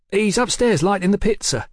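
put-on young waiter. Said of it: "the boy said".

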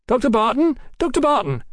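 hands up, calling the speaker's name; eager calling out. A narrator's reading. Audience men calling out.

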